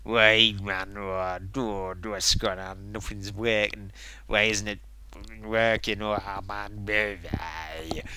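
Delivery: low-key imitation of a grumbling streamer; mock sulky anger. Imitating streamer sitting angry at broken stream, mock grumble. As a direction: grumble it downward, as an impression. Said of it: "just sits there looking angry".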